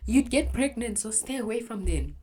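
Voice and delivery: high, sing-song mocking mom nagging. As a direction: parrot mom's nagging lecture, mocking it. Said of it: "every conversation about boys would end up in".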